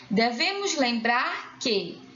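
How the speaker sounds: ordinary delivery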